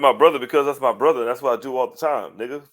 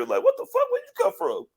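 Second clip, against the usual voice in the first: very high, startled outburst; mock astonishment at Jimmy popping up; mock shock. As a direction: squeak it out in disbelief.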